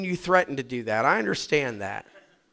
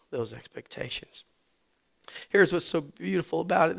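ordinary talk, nothing marked

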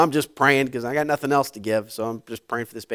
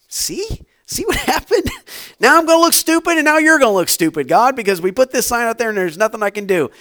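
much higher, louder exasperated whine at God; hammed-up frustrated rant; playfully furious at God; mock-angry complaint; 10 semitones higher, 8 dB louder than usual.

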